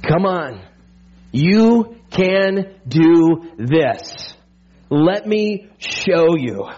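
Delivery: slow, gentle call to come on; coaxing encouragement. Acted. Preacher voicing God urging his people out, slow and coaxing. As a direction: draw them forward tenderly.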